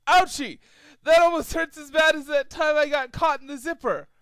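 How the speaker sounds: loud, high silly voice; goofy pained whine. Acted. Skeleton colt complaining to Trixie, loud high goofy whine. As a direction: whine like a cartoon victim.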